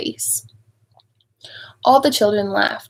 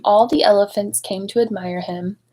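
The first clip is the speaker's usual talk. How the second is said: plain reading voice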